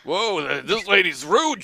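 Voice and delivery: ordinary talk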